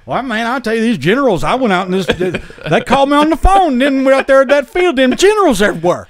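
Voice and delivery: put-on country accent. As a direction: play a rattled Georgia country boy telling a wild tale. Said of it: "they like your country accent".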